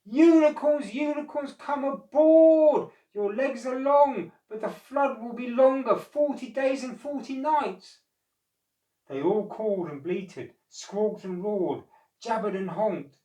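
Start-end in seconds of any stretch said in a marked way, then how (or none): 0.1-8.0 high, loud chorus call, stretched aboard; animals shouting. A narrator's reading. All the animals shouting to the unicorns, high and loud. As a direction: bellow across the flood. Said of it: "began to shout".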